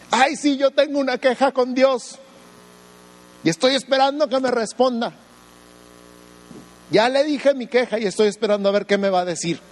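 high, whining mock complaint voice; whiny complainer. Pastor mocking a believer who complains to God, high whiny voice. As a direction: mimic a petulant believer nagging God.

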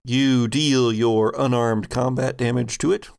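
ordinary talk, nothing marked

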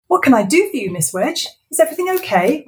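high, loud fake-casual; forced relaxed. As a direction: pretend to be calm. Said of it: "trying to sound relaxed".

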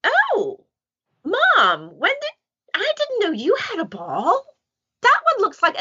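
high, bright dog voice; a dog talking to its mom; dog's voice. Pretend. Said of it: "And he's going".

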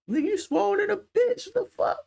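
very high, over-excited squeak; mock gushing. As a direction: gush far too much over his muscles. Acts too-excited compliment he would avoid.

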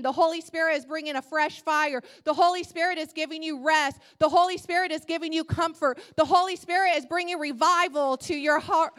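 building from plain to loud, fast preaching; rising prophetic proclamation. Pretend. God's promise to Sally, building to a loud fast preach. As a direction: declare it like a prophet, building to full force. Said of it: "It represents".

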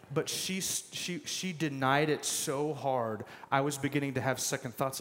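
usual speaking voice

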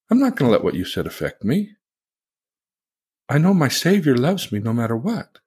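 calm spiritual response. Shown as a demonstration. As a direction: answer the insult with unshaken love.

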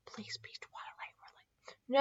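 hushed pleading whisper; soft whispered hope. Real, not acted. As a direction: breathe it as a quiet prayer.

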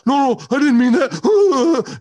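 hammed-up panicky backpedalling, very high and loud; mock a stooge pleading in a high, drawn-out whine; Cole. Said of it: "And then here comes Cole".

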